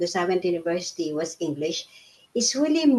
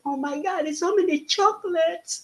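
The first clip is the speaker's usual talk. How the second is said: higher, excited retelling of her first sight of the shop; amazed delight; 10 semitones higher than usual. Retold or imitated. Newly arrived immigrant marvelling at chocolates and apples, excited and higher. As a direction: gush over the chocolates like a wide-eyed newcomer. Retold delight at UK grocery store.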